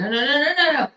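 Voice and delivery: high, rapid string of refusals; retold frantic protest. Telling him not to pick her up, mock-frantic high protest. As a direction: act out stopping him, pitch climbing.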